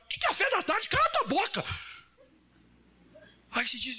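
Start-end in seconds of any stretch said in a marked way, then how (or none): 0.1-1.6 mock angry snap. Moody coworker suddenly snapping at a friendly colleague, angry. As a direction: bite their head off.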